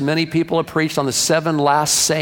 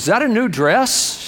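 higher, questioning husband's voice; suspicious husband; 7 semitones higher than usual. Acted. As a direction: ask it as a mildly accusing husband. Husband questions wife's secret purchase.

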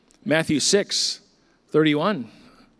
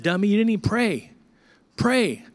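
mock self-scolding; 6 semitones higher than usual. Scolding himself in retold thought.

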